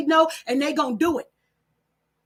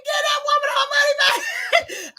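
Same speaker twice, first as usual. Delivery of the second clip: yelling at the screen; 10 semitones higher than usual. Retold or imitated. Host yelling at the TV for the woman. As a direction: holler it at the TV, laughing. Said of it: "I was yelling at the screen".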